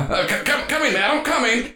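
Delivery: high, stuttering robot butler; jerky animatronic voice. Acted. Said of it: "this animatronic voice".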